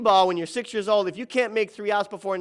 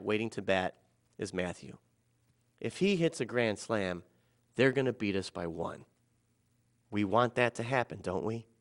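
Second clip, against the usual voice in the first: low, soft and measured; hushed, steady persuasion with pauses; calm, quiet reasoning; 7 semitones lower, 7 dB quieter than usual. Retold or imitated. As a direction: talk an angry man down quietly. Calming coach, gently making him see.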